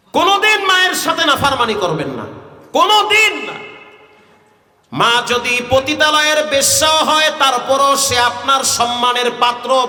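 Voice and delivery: high, emphatic exhortation; forceful preaching. Acted. (ওয়াজের চড়া গলায় জোরালো নসিহত)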